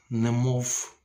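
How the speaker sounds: ordinary talk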